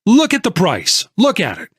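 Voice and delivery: an angry investor explodes at the narrator; exploding. A narrator's reading. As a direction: blow up at him over the price. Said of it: "before he exploded".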